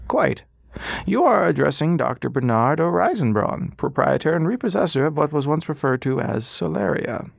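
patient, polite, in no hurry; old doctor. Acted. Old doctor to silent Ras, high and courteous.

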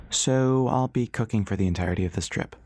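plain reading voice

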